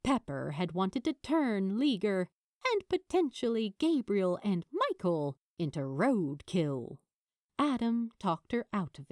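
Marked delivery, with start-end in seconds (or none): none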